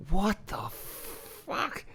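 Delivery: very slow, drawn-out whisper of disbelief; hushed, long-drawn astonishment; incredulous whisper. Real, not acted. Incredulous at the grown man's behaviour.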